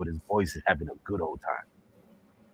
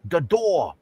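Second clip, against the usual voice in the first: put-on raised-pitch caricatured Chinese accent; mock Chinese accent; 8 semitones higher, slower than usual. Shopkeeper greets a customer, mock Chinese accent. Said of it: "the Chinese man says".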